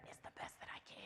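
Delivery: whispered confession. Real, not acted. Admitting cereal for dinner, whispered. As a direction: breathe it like a secret.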